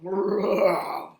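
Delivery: loud billy-goat growl; storybook beast growl; gruff growl. A narrator's reading. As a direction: let out a big rumbling growl. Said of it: "gave a gruff growl".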